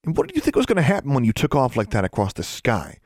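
high-pitched indignant outburst, building; demanding. A narrator's reading. David confronts Alexandra over playing chicken, demanding. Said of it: "David demanded".

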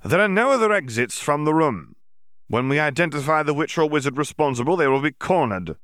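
hint of a stern officer; lightly voiced stern Auror. A narrator's reading. Yaxley retorts.

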